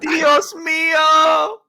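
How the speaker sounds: slow, high shouted "Dios mío"; dramatic exclamation. Real, not acted. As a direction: cry it out melodramatically. Exclaiming over Ellen Burstyn.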